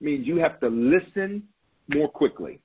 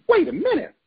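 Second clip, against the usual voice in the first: "Wait a minute" jumps much higher and louder; surprised exclaim; 13 semitones higher, 4 dB louder than usual. Real, not acted. Preacher catching the congregation's attention, high and startled. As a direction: burst out in sudden realisation. Sudden realisation.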